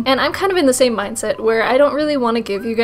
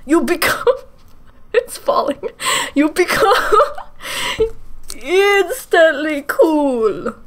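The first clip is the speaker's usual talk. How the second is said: higher, slow put-on voice breaking into laughter; hammed-up laughing; 5 semitones higher, slower than usual. Youtuber showing a fake mustache, hammed-up and laughing. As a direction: announce the mustache trick grandly and lose it laughing.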